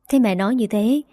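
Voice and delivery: usual speaking voice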